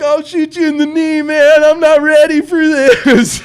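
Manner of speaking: high, loud, slow panicked squeal. Retold or imitated. Facing an attacker, hammed-up panicked high squeal. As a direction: squeal it out in mock terror. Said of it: "'cause I'm like".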